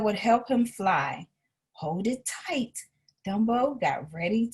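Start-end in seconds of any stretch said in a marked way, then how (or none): 1.7-2.9 soft and slow, holding it close; soft slow encouragement; gentle urging. A narrator's reading. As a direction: reassure the scared elephant quietly. Timothy urges Dumbo.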